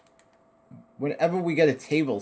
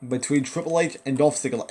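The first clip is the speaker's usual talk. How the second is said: ordinary talk, nothing marked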